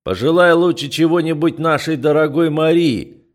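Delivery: loud, high, imperious; commanding. Acted. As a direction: order it like the family head. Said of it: "властно предложил Грегор".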